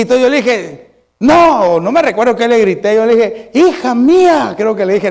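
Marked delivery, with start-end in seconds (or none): 1.2-1.8 higher, louder acted cry; retold shout. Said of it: "algo le tuve que haber gritado". 3.5-4.5 high, drawn-out parent's cry; retold shout. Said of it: "algo le tuve que haber gritado".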